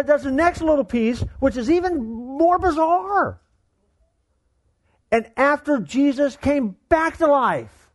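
high-pitched, incredulous preaching; amazed preaching. Real, not acted. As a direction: retell it in astonishment, pitched high, with pauses for effect. Preacher excitedly retells bizarre passage.

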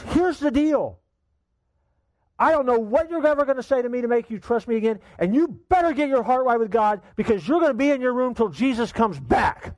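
high, loud railing; angry father. Retold or imitated. Father sentencing his son to his room, loud and high. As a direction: lay down the law furiously.

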